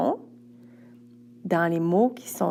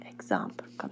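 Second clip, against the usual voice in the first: whispered aside; 12 dB quieter, faster than usual. Acted. Teacher demonstrating an example aside.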